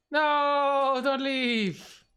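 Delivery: high, drawn-out protest; dismayed plea. Real, not acted. Caster begging player Enzo not to leave, dismayed. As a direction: wail it in disappointment, stretching the words.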